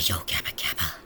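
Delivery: normal talking voice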